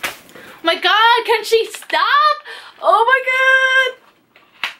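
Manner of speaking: very high, drawn-out squeal of delight; real high-pitched gushing; overjoyed squeal. Real, not acted. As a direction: squeal slowly, overwhelmed by the gift. Overjoyed at next gift revealed.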